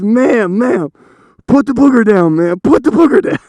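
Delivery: high, loud hammed-up police order; mock urgent command. Officer to woman with booger, mock urgent shout. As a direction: shout it like a comic armed standoff.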